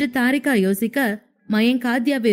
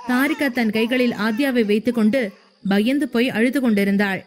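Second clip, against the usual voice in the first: plain reading voice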